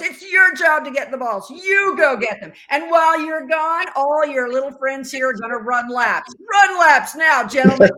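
loud, high furious barking orders; rising to a shout on run laps; angry instructor. Retold or imitated. Umpire instructor punishing the class for her mistake, loud angry shout.

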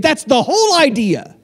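ordinary delivery

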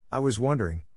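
ordinary delivery

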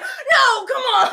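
mock him begging her to admit it; laughing mock whine; mocking his protest. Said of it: "was really mad".

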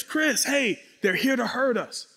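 high-pitched urgent call, not shouted; urgent warning. Retold or imitated. Young man warning Chris they'll be hurt, urgent. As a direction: call a friend back from danger. Warning his friend of danger.

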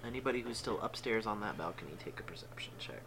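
hushed aside to the table; whispering. Real, not acted. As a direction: whisper it so others can't hear. DM secretly addressing upstairs players.

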